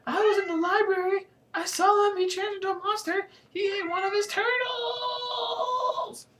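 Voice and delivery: frantic high-pitched wailing; shrill, panicky. A narrator's reading. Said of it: "my shrill, panically voice".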